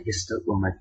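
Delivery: normal talking voice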